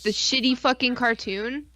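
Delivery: usual speaking voice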